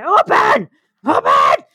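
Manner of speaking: frantic shouts at a can; yelling. Acted. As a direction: yell it desperately.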